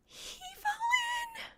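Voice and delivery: very high tiny squeaking cry. Acted. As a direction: squeak it out in horror. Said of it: "she squeaks".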